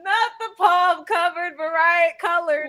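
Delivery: high, loud, crying with laughter; real high-pitched laughing squeal; laughing disbelief. Real, not acted. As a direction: repeat it through helpless laughter. Still cracking up, repeating the joke.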